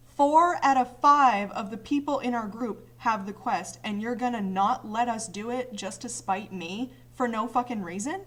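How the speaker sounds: loud, exasperated rant; retold angry retort. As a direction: let the frustration pour out loudly.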